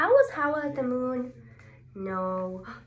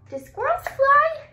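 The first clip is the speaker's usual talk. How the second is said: mock surprise; 7 dB louder than usual. Teacher mock disbelief to kids.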